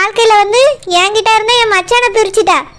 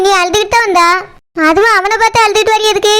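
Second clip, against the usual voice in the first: ordinary talk, nothing marked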